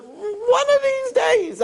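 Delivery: high, slow and stretched out; mock wishful promise. Grown child putting off time with parents, mock wishful. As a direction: draw the vague promise out way up high.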